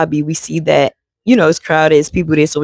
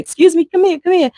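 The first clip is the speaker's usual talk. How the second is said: high, sing-song calling out; calling lady; 12 semitones higher than usual. Retold or imitated. Information desk lady calling the family back over, high and sing-song.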